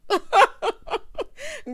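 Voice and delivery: loud, high-pitched laughter; real laugh. Real, not acted. Recapper amused by grandma's misunderstanding, laughing loudly. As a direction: burst out laughing at your own joke. Laughs at her own joke.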